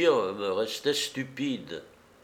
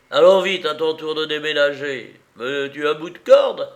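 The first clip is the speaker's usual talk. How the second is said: high, loud cackling gloat; gloating villain; 4 semitones higher, 5 dB louder than usual. Acted. The crippled bandit taunts Lupin over Florence, high loud gloating. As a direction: savour every cruel word. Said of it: "il disait cela avec une telle volupté".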